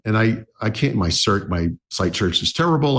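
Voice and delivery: ordinary talk, nothing marked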